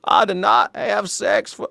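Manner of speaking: slow, high old-guy impression; Bill Clinton. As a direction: do a politician's denial as an old man. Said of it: "my Bill Clinton impersonation is just an old guy".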